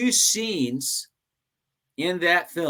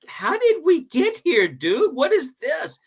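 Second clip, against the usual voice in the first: stunned disbelief; 14 semitones higher, slower than usual. Retold or imitated. Two actors shocked at script.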